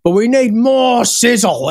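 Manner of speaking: put-on high, slow old rocker nagging the engineer. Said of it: "Angry kept going at him".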